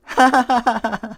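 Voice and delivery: high pleased giggle; a zebra's delighted little giggle; happy giggle. Acted.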